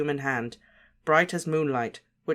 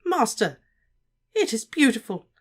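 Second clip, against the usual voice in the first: higher, trembling awe; awed gratitude; 8 semitones higher, 4 dB louder than usual. A narrator's reading. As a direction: marvel at the gift breathlessly. Wormtail awed by new hand.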